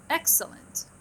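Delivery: "Excellent" high on EX, dropping on the last two syllables; falling tune. Shown as a demonstration. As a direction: hit the first syllable of "Excellent" high, then let it fall. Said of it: "نبدي بصوت عالي وبعدين صوت ناصي".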